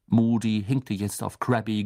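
ordinary delivery, nothing marked

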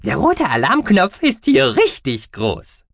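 very high, slow, squeaky mouse voice; squeaky creature voice. A narrator's reading. Gucki the mouse-beaver speaks.

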